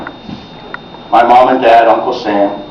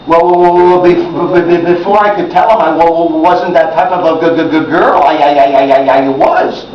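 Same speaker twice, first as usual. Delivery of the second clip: put-on heavy stuttering boy. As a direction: repeat and stumble on each word. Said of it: "But stutter jokes".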